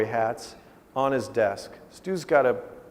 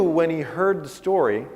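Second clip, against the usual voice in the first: normal talking voice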